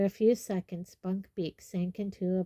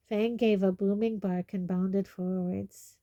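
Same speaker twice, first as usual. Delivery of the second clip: ordinary delivery, nothing marked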